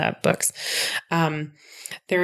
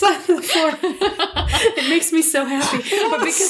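high, giggly delight; laughing; 10 semitones higher than usual. Real, not acted. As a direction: laugh through the words. Laughing at the glove gag.

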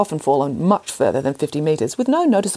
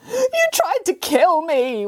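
high, slow, crying wail; 12 semitones higher, much slower than usual. Acted. Cat to Nanny Piggins, slow crying wail. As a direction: wail it out in betrayal. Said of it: "wailed the cat".

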